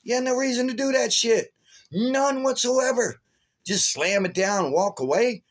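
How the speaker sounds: retold outburst, high and indignant; high-pitched, exasperated telling-off; angry confrontation. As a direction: relive chewing him out, pitch way up. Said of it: "I was pissed, man".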